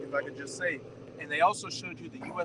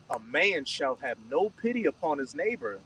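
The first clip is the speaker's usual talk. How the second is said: slow, solemnly paced verse reading; scripture reading; 3 semitones lower, slower than usual. Acted. Reader giving verse nineteen to the group, slow scripture reading. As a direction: read it out slowly, phrase by phrase.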